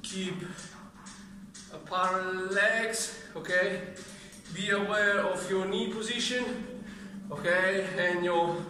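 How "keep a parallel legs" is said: ordinary talk, nothing marked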